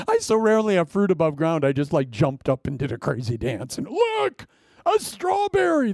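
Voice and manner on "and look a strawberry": high mock lament. Mock wounded over strawberry.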